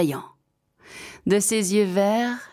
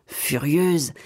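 ordinary delivery, nothing marked

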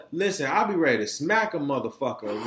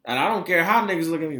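ordinary delivery

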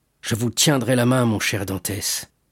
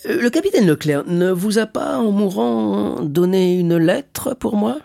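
high, drawn-out hesitant question; shipowner, probing; 8 semitones higher, slower than usual. Acted. Shipowner asks about the dying captain's letter, tentative. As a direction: feel your way slowly through the question.

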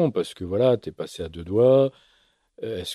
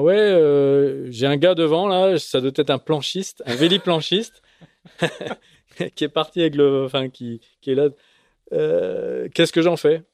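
raised, excited radio call; 6 semitones higher, 4 dB louder than usual. Retold or imitated. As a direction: call it in over the VHF. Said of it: "je l'entends appeler le comité de course".

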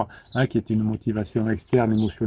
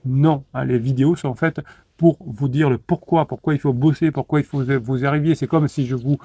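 normal talking voice

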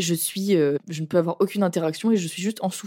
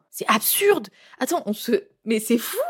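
whispered, appalled; whispered outrage; 3 semitones higher than usual. Real, not acted. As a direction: hiss it in disbelief. Outrage at withdrawal bleeding.

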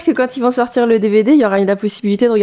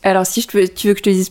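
normal talking voice

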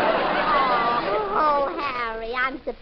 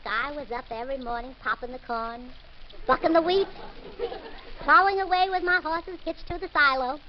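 usual speaking voice

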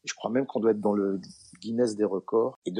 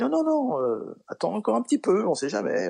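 high-pitched, insistent plea to wait; urgent protest; 11 semitones higher, 4 dB louder than usual. Retold or imitated. Comedian begging his discouraged friend to wait, urgent protest. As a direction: jump up high, then ease down coaxingly.

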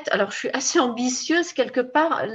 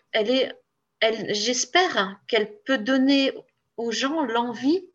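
ordinary talk, nothing marked

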